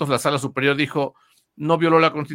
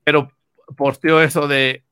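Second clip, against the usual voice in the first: usual speaking voice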